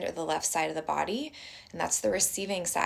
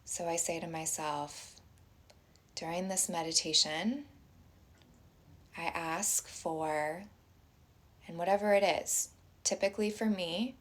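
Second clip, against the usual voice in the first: soft, unhurried meditation voice with long pauses; guided meditation; 5 dB quieter than usual. Acted. Teacher inviting everyone to close their eyes and breathe, guided meditation.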